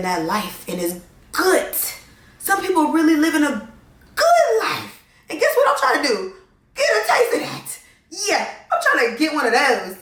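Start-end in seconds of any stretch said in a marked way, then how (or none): 2.4-5.0 much higher, airy, swooning over the good life; dreamy high sing-song. Shown as a demonstration. Dreaming aloud to viewers about a good life, high sing-song. Dreamy take on the same line. 5.3-7.8 much higher, giggly, teasing; playful high voice. Acted. Telling viewers she wants that life, playful high voice. Playful wish after the dreamy line.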